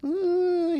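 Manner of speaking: high loud mock wail of a crying child; imitated childish sob-wail; child's wail. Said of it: "I'm crying".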